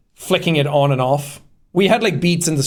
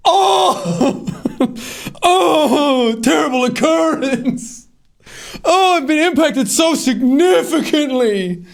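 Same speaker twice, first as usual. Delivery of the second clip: high, slow, theatrical anguish with a fake sob; mock melodramatic wail; 12 semitones higher, slower than usual. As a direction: ham up the victim's despair, drawn out and high. Mocking the dramatic film line.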